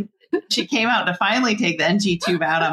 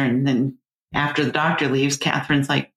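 ordinary delivery

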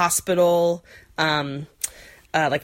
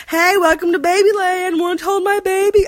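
much higher, louder, sugary greeter voice; cheery costumed hostess; 12 semitones higher, 5 dB louder than usual. Pretend. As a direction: play the over-sweet nurse welcoming visitors. Imagined greeting in nurse costume.

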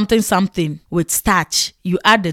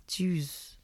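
disappointed; 16 dB quieter than usual. Retold or imitated. Disappointed at fufu.

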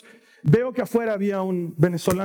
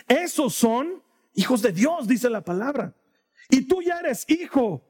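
preaching appeal; 4 semitones higher than usual. Acted. Llamado final del predicador. (predicación lenta y suplicante)

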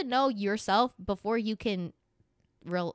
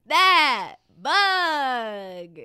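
drawn-out teasing; 7 semitones higher, 9 dB louder, much slower than usual. Pretend. Teasing the bug eater.

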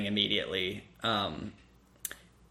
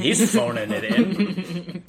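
laughing; 9 semitones higher, 3 dB louder, much slower than usual. Real, not acted. High laughing delivery.

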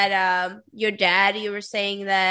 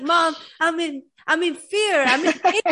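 raised, mock-panicked plea, higher and louder; hammed-up cry of fear to mom; 7 semitones higher, 4 dB louder than usual. Daughter telling her mom she's scared, mock-panicked plea.

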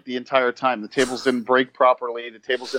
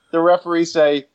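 normal talking voice

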